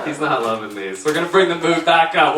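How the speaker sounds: ordinary talk, nothing marked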